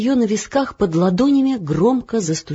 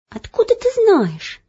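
put-on much higher, sulky girl's question; grumbling girl; 14 semitones higher than usual. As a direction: Elo grumbling at Olya. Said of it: "проворчала Ело". (ворчливо)

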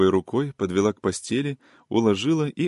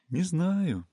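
pensive; 8 semitones higher, 5 dB quieter than usual. A narrator's reading. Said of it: "сказала задумчиво".

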